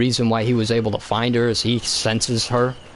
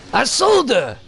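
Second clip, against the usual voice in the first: much higher, loud, slow, drawn-out mocking creature voice; Watto impression; 12 semitones higher, 3 dB louder, slower than usual. Watto greeting grown-up Anakin, high loud mocking drawl. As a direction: ham up a greedy junk dealer taunting. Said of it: "And then he's like".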